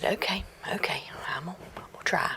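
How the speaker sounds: hushed, hurried agreement; nervous whisper. Acted. Boy agrees to try the haunted house, in a nervous whisper. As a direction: whisper it like you're already spooked.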